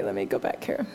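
ordinary delivery

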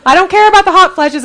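laughing. Real, not acted. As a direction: laugh while insisting, fast. Jokes about hormones, laughing.

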